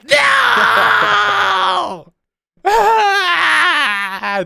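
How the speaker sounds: high, very loud wail of despair; anguished scream. Acted. Game master voicing the beggar collapsing in the street. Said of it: "collapse into the street screaming".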